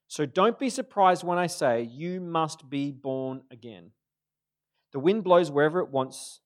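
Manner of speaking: a steady, declaiming read of Jesus' words; scripture reading. Acted. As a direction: proclaim the passage to the congregation. Reads the scripture passage aloud.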